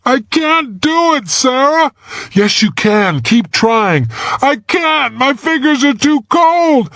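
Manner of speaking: put-on high, strained young voice; helpless and stuck, pleading; boy wedged in ice, pleading up to Sarah; trapped boy. A narrator's reading. Boy failing, Sarah urges.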